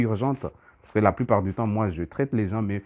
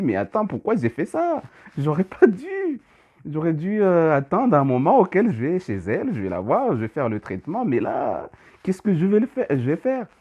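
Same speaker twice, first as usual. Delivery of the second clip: higher, alarmed inner voice; retold panic; 7 semitones higher, 3 dB louder than usual. Healer recalls doubting his remote treatment, alarmed higher voice. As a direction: replay the rising worry as it hit.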